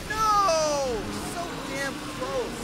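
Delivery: dismayed shout. Real, not acted. Caught by the monster.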